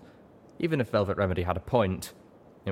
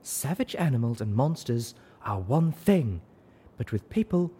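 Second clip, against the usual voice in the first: glowering; 6 semitones higher than usual. A narrator's reading. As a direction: lecture them with disapproval. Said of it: "panting as she fixed us all with a glower".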